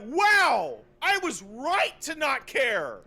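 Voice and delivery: high, slow, sarcastic exclamation; mock excitement. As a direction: gush about it sarcastically, drawn out. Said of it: "And I'm like".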